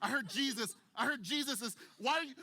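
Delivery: loud, high, frantic pleading through a crowd; desperate woman forcing her way past people; frantic pushing. Retold or imitated. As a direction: shove through the crowd calling out. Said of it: "And she goes".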